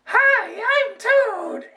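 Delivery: very high, strained squeaky Toad voice. Shown as a demonstration. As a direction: squeeze out a tiny mushroom-man squeak. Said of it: "All right, here he goes".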